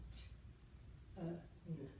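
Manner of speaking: "T", "uh", "n" each alone with pauses; separate sounds. Shown as a demonstration. Teacher gives the sounds in tin, "T, uh, n" separated. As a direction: sound out "T", "uh", "n" one by one. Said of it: "Tell me the sounds in tin".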